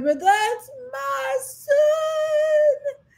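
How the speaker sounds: very high, long-drawn tearful cry; anguished wail. Retold or imitated. Mother cries up to God for her son, anguished wail. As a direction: wail it out, stretching every word.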